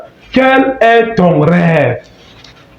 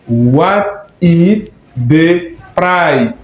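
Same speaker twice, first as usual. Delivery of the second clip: very slow, every word laid out; slow model English; much slower than usual. Shown as a demonstration. Teacher modelling English slowly for French learners. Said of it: "quel est le prix en anglais".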